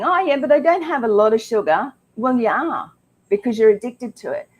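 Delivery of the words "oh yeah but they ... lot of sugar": higher-pitched mock protest; mimicked objection. Host mimicking people out there who doubt the sugar, mock protest. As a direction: imitate someone brushing it off, pitched up. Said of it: "those out there that are going".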